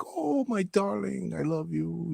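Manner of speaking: high, slow, soft grandma voice; imitating a loving French grandmother. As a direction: coo it like a tiny French grandmother. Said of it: "in her little French accent".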